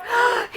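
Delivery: sharp high gasp; startled gasp. Acted. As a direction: gasp in fright. Friend startled.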